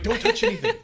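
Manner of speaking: high laughing mock panic. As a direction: squeal it in playful alarm. Retold panic about toxic ants.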